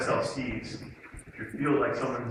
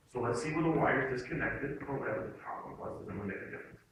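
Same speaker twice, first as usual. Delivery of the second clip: softer, lower, reassuring owner; calm; 3 semitones lower, 6 dB quieter than usual. Retold or imitated. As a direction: soothe an upset customer as you imitate the owner. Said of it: "he remains calm".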